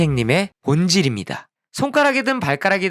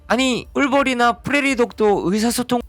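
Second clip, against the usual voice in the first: raised pitch objection; protesting student; 8 semitones higher than usual. Pretend. A student objects to the textbook, voice up high. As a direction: pipe up higher in protest. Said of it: "student objecting to textbook".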